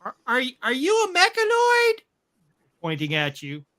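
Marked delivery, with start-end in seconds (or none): 0.0-2.0 put-on high curious child; little kid voice. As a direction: ask it like an awestruck kid. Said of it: "you see one of the kids going".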